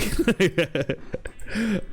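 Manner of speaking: amused laugh, rising high; high, giggly burst of laughter. Real, not acted. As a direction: laugh at your own teasing. Laughs after joking about bullying.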